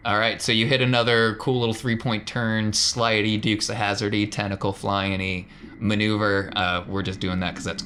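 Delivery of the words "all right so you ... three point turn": normal talking voice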